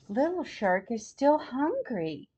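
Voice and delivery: ordinary delivery, nothing marked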